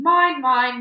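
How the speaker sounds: loud possessive shout. Acted. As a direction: shout it over the noise. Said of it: "He shouted over the din".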